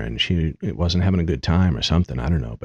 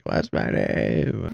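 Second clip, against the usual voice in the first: slow, held-back distant holler; mock yell; much slower than usual. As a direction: stretch it out like a far-off call. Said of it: "I just randomly yell it".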